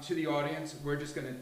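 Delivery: usual speaking voice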